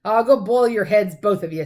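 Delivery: loud threatening giant; angry threat. A narrator's reading.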